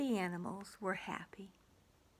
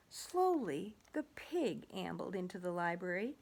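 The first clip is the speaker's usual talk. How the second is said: ordinary delivery, nothing marked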